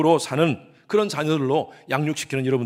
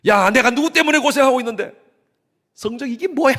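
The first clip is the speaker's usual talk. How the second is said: shouted, much higher and louder; angry father yelling; 9 semitones higher, 10 dB louder than usual. Retold or imitated. Father angrily yelling at his children over report cards. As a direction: scold the kids over grades. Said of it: "이렇게 얘기하지 않고".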